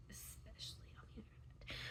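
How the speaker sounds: breathy whispered repeat. Real, not acted. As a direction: whisper the phrase under your breath.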